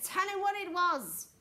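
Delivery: high, loud, drawn-out order; commanding. A narrator's reading. Miss Tibbs ordering the interpreter to answer, commanding.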